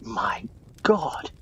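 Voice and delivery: hushed gasp of recognition; stunned whisper; shocked whisper. Acted. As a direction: breathe it out in disbelief. Recognising the lost explorer.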